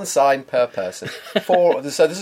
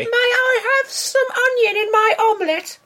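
high falsetto lady voice; squeaky polite woman voice. Pretend. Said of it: "I'll speak in a lady voice".

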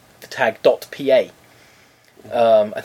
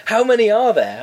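higher-pitched mimicked scammer; scammer's eager question; 9 semitones higher, 4 dB louder than usual. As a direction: swoop up high, imitating the caller's question. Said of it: "He said".

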